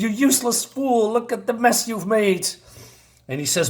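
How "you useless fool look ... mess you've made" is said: high-pitched scolding, acted; angry. Angry wizard to Adam in a retelling, mock scolding. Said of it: "he is angry".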